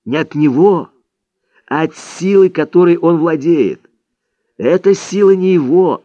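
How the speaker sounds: earnest teacher's warning. A narrator's reading. Don Juan warning his pupil about Vicente's power, earnest and emphatic.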